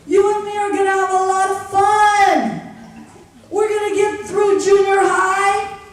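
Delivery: a guardian angel shouting a cheerful promise; rousing pep talk. Pretend. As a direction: fire up a crying kid with big plans. Imaginary friend's rousing pep talk.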